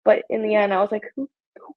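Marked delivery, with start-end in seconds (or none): 1.2-1.7 whisper. Retold or imitated. Shrugging it off in retold thought.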